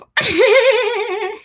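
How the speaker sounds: high, rippling giggle; delighted giggle. Real, not acted. Seeing the toy's little soup, delighted high giggle.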